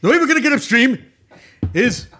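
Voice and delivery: put-on old-campaigner bluster as a boat captain; Bernie Sanders impression. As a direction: pitch it up and punch it like Bernie Sanders. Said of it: "this Bernie Sanders guy".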